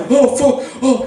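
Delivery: high-pitched mock panic; imitated freak-out. Brother freaking out on heroin, mock panicked. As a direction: act out his panicked exclamations. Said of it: "I'm freaking out on heroin too".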